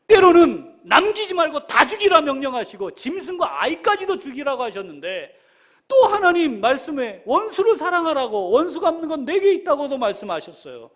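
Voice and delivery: high, loud argument with God; fervent preacher's appeal, easing at the end; impassioned pleading. Acted. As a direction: press the puzzle urgently in prayer. Acted prayer questioning God, building intensity.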